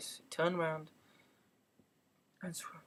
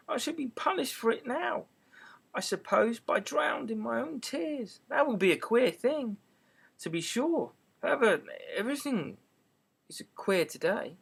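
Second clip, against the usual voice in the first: Alice voice; 6 semitones higher than usual. A narrator's reading.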